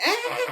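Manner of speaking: brain-boiling scream; mock scream as brains boil; high scream. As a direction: shriek in pain. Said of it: "he's like".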